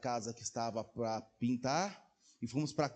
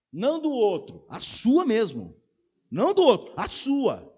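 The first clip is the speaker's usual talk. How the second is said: emphatic preaching; 9 semitones higher, 9 dB louder than usual. Real, not acted. Preacher pressing the point.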